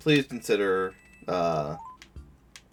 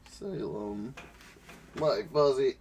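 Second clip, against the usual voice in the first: ordinary talk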